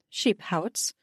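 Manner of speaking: ordinary delivery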